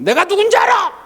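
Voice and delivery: high-pitched imitation of an irate customer; mock angry outburst. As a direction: act out an indignant restaurant customer. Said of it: "막 화를 내더래요". (화난 손님 흉내 내며 호통치듯)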